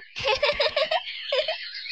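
high, bubbling laugh mid-game; playful bird laugh. Acted. Birds laughing while playing catch in the trees.

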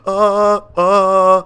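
loud, high stalling noises with a yelp; flustered panic. Real, not acted. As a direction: caught out and at a loss. Spotted by the house owners.

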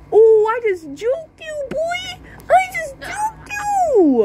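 high, slow mock-gloating singsong; taunt. Boy taunting the kid who fell, in a slow high gloat. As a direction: gloat like you just won.

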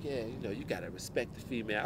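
lightly voiced pimp, tossed off fast under the breath; hushed, rapid mock pimp patter. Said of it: "still got the pimp voice".

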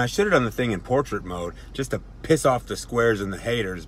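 normal talking voice

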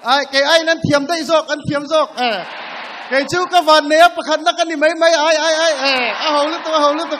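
high, loud excited cheer; excited shouting. Real, not acted.

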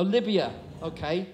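usual speaking voice

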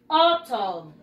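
AUTUMN stressed at the start, then falling away; model pronunciation. Shown as a demonstration. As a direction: say "Autumn" slowly for pupils to copy. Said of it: "Let's practice again the pronunciation". (pronunciación modelo, alargada)

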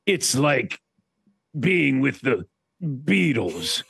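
slow, lightly put-on boastful voice; imitating Chris. Chris bragging to [name], slow mock-grand voice. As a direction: stretch it out grandly. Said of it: "then he said to me".

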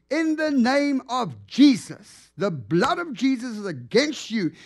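retold prayer shouted with authority; commanding prayer. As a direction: proclaim it over the man with full force.

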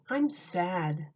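ordinary delivery

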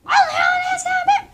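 high, loud teasing imitation; mocking. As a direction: parrot it back in a silly high voice. Mocking the other child's words.

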